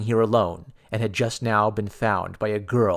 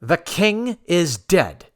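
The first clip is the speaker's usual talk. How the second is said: louder, slow call; crowd shouting; 6 semitones higher, 4 dB louder, much slower than usual. A narrator's reading. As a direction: cry out over the din. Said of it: "voices shouting".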